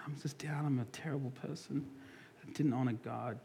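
soft, hushed self-pity; mock remorseful whisper. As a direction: whisper it like a guilty penitent. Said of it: "Cain's not like".

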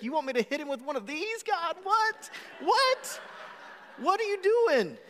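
rising to a high, laughing squeak of disbelief; incredulous panic. Retold or imitated. Panicked pleading with God.

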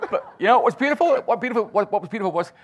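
excited. Real, not acted. Recovering from laughter, still high.

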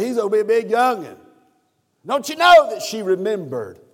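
loud, high, driving sermon cadence; preaching at full force. Acted. Preacher stirring the mothers in the congregation, loud and high. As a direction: preach it up to the rafters.

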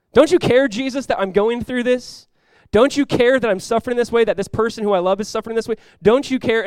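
high, loud reproach of Jesus; accusing. Acted. Sufferer demanding of Jesus why he won't care, accusing. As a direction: fling it out as an accusation, not a question. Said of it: "It's really an accusation".

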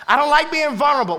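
loud, high climax of a sermon, near shouting; preaching at full force. Acted.

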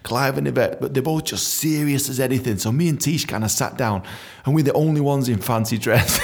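usual speaking voice

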